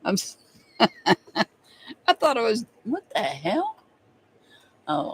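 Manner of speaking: normal talking voice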